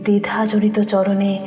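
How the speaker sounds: ordinary talk, nothing marked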